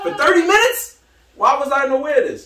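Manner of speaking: high, loud mock disbelief; incredulous. Retelling his discovery of Cheers, high incredulous outburst. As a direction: exclaim it in high-pitched amazement.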